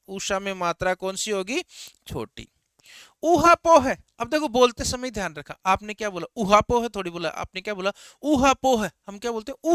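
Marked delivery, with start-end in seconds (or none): 3.2-4.0 ऊहापोह with a long, loud, high ऊ at the start; stress on the first ऊ. Shown as a demonstration. Teacher drilling the class on the long first vowel, loud. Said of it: "ऊपर ज्यादा जोर लग रहा है ना". 8.2-8.9 ऊहापोह with a long, loud, high ऊ at the start; stress on the first ऊ. Shown as a demonstration. Teacher drilling the class on the long first vowel, loud. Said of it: "ऊपर ज्यादा जोर लग रहा है ना".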